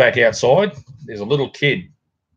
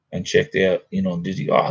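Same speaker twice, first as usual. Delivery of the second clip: normal talking voice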